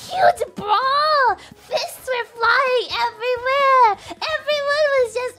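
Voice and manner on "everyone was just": ordinary delivery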